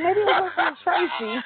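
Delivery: high, laughing guess; joking guess. Retold or imitated. Wife jokes the gift came from her auntie, laughing. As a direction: giggle through the guess.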